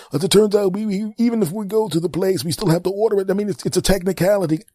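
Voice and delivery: high, rambling excuse-making rep voice; high mock customer service voice; babbling company rep. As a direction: pile up nonsense excuses cheerfully.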